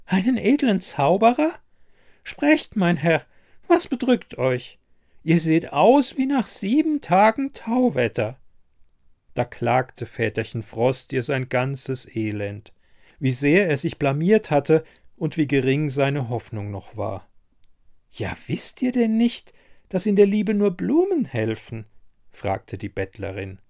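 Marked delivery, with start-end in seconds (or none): 0.0-8.4 high crone voice, curious and forward; high-pitched, croaky old woman; croaking old beggar woman. A narrator's reading. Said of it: "krächzte sie". 18.1-21.9 high crone voice, knowing question; high-pitched, croaky old woman; croaking old beggar woman. A narrator's reading. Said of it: "krächzte sie".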